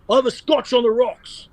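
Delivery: high-pitched put-on accent impression; Con Constantine accent. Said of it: "And Con goes".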